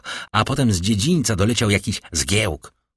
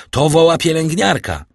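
raised, high voice; urgent reply; 7 semitones higher, 5 dB louder than usual. A narrator's reading. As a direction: call out the explanation urgently. Doctor urgently explains the cry. (podniesiony, naglący głos)

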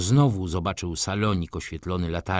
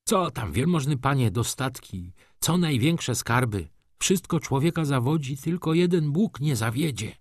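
higher-pitched, earnest simple believer; consoling railwayman; 6 semitones higher than usual. Acted. Railwayman comforts a gentleman about worldly goods, earnest. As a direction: console him in a raised, fervent voice.

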